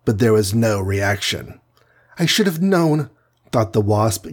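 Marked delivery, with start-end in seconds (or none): 2.2-3.1 higher-pitched voice of resigned defeat; mildly exasperated admission to oneself; giving up. A narrator's reading. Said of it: "giving up".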